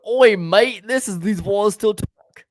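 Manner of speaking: mock Australian, high and loud on Oi mate; hammed-up Aussie bloke greeting; playful Australian accent. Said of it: "Maybe you should just always talk in an Australian accent".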